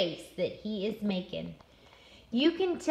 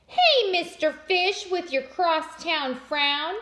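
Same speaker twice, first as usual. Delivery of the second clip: higher, bright-hearted clam giving advice; cheerful clam; 6 semitones higher, 3 dB louder than usual. Acted. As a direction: coax him to smile.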